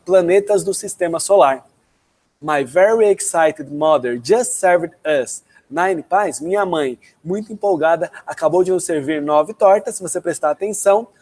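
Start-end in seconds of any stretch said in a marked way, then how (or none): 2.4-6.4 slow, deliberate English sentence; slow mnemonic demo. Shown as a demonstration. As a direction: spell out each word slowly for memorizing. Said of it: "Um exemplo de como os americanos usam mnemônico".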